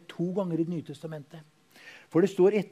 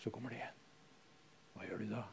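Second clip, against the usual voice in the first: much softer, lower and hushed; 7 semitones lower, 19 dB quieter, much faster than usual. Acted. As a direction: drop to a near hush for suspense. Dramatic hush after joy.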